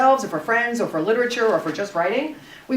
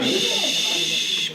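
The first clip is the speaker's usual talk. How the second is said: drawn-out, whispery shush; long hushing shh; 2 semitones higher than usual. Real, not acted. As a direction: hush a chattering class with one long shh. Teacher hushing a noisy class.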